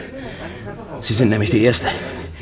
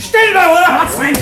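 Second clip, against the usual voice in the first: defiant shout; 7 dB louder than usual. Acted. Thief shouting back while fleeing.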